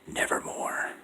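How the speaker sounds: unvoiced, distant raven whisper; eerie far-off raven; raven's whisper. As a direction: breathe it like a ghost bird. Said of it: "Then the bird said".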